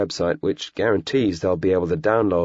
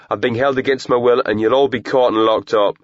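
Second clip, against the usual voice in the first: angry defiance; 5 semitones higher, 6 dB louder than usual. A narrator's reading. Angry captive threatening.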